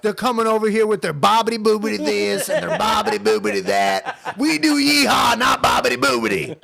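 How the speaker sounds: hammed-up rant, building to a shout; angry KKK member. Imagined Klansman griping about Italian immigrants, hammed-up anger. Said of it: "like, the K K K being so mad at Italians".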